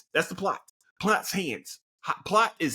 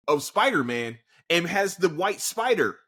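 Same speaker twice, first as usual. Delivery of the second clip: usual speaking voice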